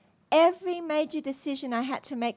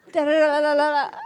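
louder hammed-up sob noise; mock wailing da-da-da; 3 semitones higher, 6 dB louder than usual. She wails after being skipped again, hammed-up. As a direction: wail it in a sing-song sob.

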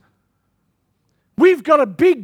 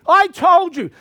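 ordinary talk, nothing marked

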